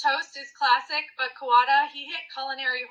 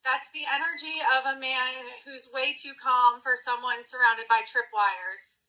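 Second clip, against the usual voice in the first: ordinary delivery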